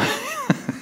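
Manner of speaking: soft, high-pitched chuckle; light laugh. Real, not acted. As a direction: laugh lightly at your own joke. Laughing at human selfishness.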